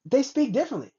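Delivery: ordinary talk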